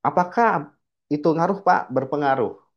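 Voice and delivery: usual speaking voice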